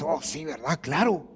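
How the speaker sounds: low, soft going-along; quietly nod along in an acted voice; understated low murmur of assent; mock agreement. Acts Judas casually agreeing.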